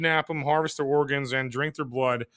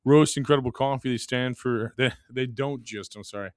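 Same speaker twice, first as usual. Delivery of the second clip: ordinary talk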